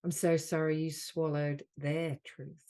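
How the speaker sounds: measured delivery, leaning on "so sorry"; guided healing affirmation. Acted. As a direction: offer each apology as a healing phrase. Leads healing phrases with long pauses.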